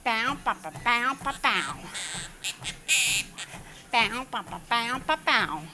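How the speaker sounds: imitated dog barking. Real, not acted.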